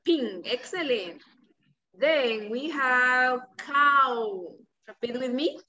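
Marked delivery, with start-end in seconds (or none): 1.9-4.5 put-on reading to a toddler. Shown as a demonstration. Teacher drawing out for child.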